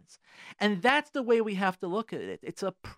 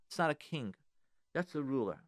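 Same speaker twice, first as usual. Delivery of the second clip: usual speaking voice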